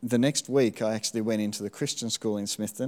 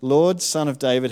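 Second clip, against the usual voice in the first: moderately raised calling voice; shouted plea; 4 semitones higher, 7 dB louder than usual. A narrator's reading. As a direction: read out as a raised, louder call. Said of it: "they shouted".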